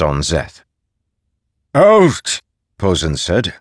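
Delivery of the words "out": high, loud order to leave; commanding shout. Acted. Posen orders everyone out of the room.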